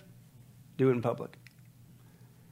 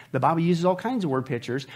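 ordinary delivery

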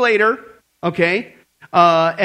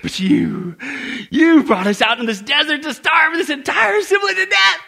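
high, loud accusing shout; a mob shouting at their leader; angry grumbling Israelite; 8 semitones higher, 4 dB louder than usual. Acted. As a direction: yell it at Moses like an angry crowd.

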